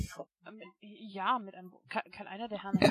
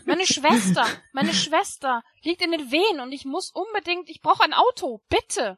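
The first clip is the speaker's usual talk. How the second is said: desperate pleading; 6 semitones higher, 10 dB louder than usual. Acted. Pleading at the door.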